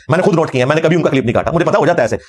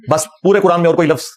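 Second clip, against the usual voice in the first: ordinary talk, nothing marked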